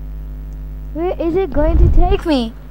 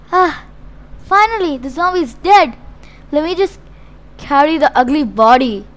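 ordinary talk

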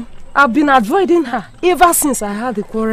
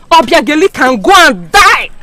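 angry curse; 4 semitones higher, 6 dB louder than usual. Acted.

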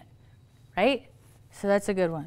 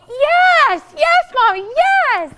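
put-on much higher and louder, squealing child's yes; excited little daughter; 9 dB louder, slower than usual. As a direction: bounce like a thrilled kid begging mommy. Daughter thrilled to play together.

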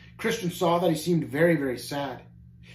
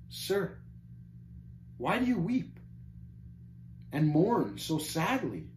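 soft opening, gently raised pitch; gentle; 3 semitones higher, 6 dB quieter, slower than usual. A narrator's reading. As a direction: ask gently, with careful pauses. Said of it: "asked gently".

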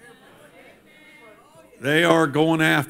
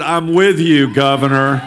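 louder, firm public declaration; open support; 6 dB louder than usual. Retold or imitated. Preacher standing up publicly for the governor, loud. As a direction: say it out loud for all to hear. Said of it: "one preacher who stood up and said".

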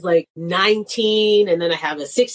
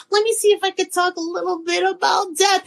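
testing the waters; 11 semitones higher than usual. Retold or imitated. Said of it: "If I see they laugh a little".

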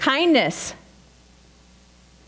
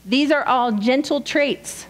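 usual speaking voice

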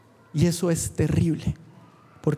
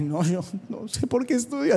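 mock whine. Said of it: "así".

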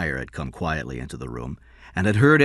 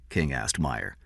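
ordinary delivery

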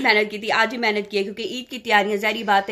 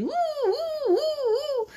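high whimpering oo oo; imitating Sandy; 3 dB quieter, much slower than usual. Mother imitating cartoon Sandy's angry squeal, high. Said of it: "Sandy कहती थी".

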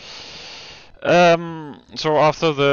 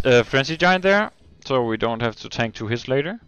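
ordinary talk, nothing marked

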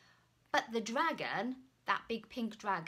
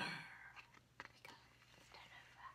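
whisper; 16 dB quieter than usual. Acted. Storyteller whispering before turning the page. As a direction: build suspense before the page turn.

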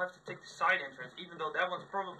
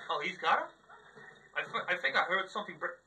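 usual speaking voice

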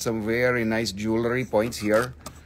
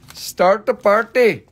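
higher, louder party call; excited call; 7 semitones higher, 6 dB louder than usual. Shown as a demonstration. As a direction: hype up the viewers. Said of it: "Somebody start the party".

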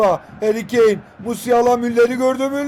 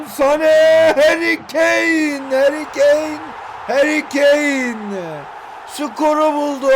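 higher, louder, stretched-out name calls; excited commentary yell; 6 semitones higher, 4 dB louder, much slower than usual. Real, not acted. Gamer yelling his striker's name as the goal comes.

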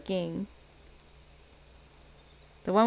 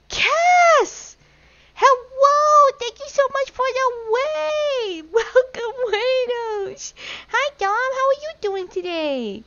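excited squeal; 5 dB louder than usual. Real, not acted. Squealed greeting to an arriving raid.

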